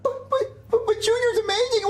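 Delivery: very high, stammering squeak; voice cracking. Acted. Son protesting being given away, voice cracking. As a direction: crack upward in tearful protest. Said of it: "why did your voice crack?".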